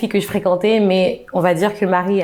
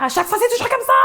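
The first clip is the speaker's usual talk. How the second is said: mock meltdown; faster than usual. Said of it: "elle pète un câble".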